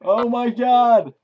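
high frantic cries to run; panicked yelling. Real, not acted. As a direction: yell in real panic. Fleeing the whole army.

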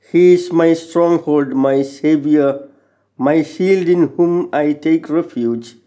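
ordinary delivery

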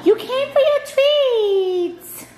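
very high, slow, loud squeal at the dog; excited gasp. Real, not acted. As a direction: squeal with delight over the dog.

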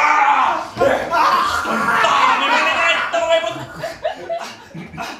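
hammed-up loud outraged shout; mock outrage. Husband staging a jealous fit for his wife, hammed-up shout. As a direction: fake a furious husband's explosion. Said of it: "devo fare una reazione".

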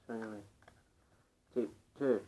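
talking around cigarette; cigarette in mouth. Real, not acted. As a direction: lips held nearly closed. Seen 0: Unlit cigarette held between lips throughout; ref frames show cigarette in hand.

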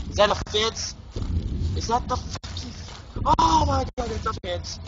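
scared cries on spotting the fence; alarmed. Real, not acted. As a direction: gasp it out in alarm.

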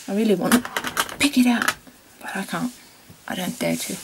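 usual speaking voice

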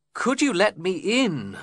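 high, pleading call for shelter; desperate plea. A narrator's reading. Lockwood begging Joseph to let him in, desperate. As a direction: beg to be let in out of the snow. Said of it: "I asked desperately".

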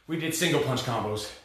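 winded, breathing hard between words. Real, not acted. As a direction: talk while out of breath after a hard workout round. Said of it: "I'm out of breath".

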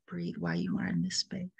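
low, quick whisper. Acted. Guide settling the listener into stillness, low whisper. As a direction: hush the cue almost to nothing.

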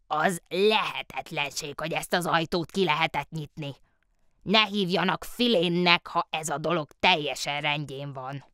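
put-on a cranky old locksmith protesting the open door; grumbling old locksmith.

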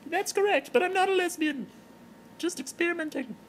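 Terry the giraffe. Acted. Terry the giraffe greeting her blog fans in falsetto. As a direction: greet the fans like a tipsy celebrity in a high voice.

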